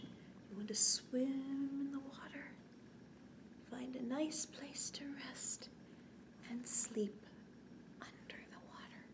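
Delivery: soft unvoiced whisper, fading away; bedtime whisper. Librarian whispering a sleepy clownfish scene to children. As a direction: lull them down to sleep in a whisper.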